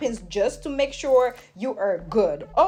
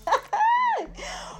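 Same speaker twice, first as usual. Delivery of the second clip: a squealing burst of laughter, much higher. Real, not acted. Vlogger cracking herself up with a high squeal. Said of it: "Why am I so hyper?".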